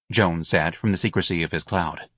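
ordinary delivery, nothing marked

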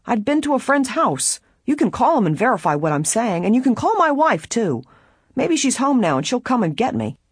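high-pitched, pleading suspect; defensive prisoner. A narrator's reading. Prisoner offers the guard witnesses for an alibi, defensive. Narrator voices the protesting prisoner.

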